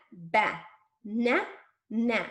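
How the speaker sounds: BANANA broken into ba... na... na, with gaps; finger-stretched syllables. Shown as a demonstration. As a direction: stretch each syllable of BANANA and pause after it. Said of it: "going to finger stretch the word banana".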